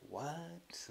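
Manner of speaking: puzzled listener. Acted. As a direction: act out a baffled listener under your breath.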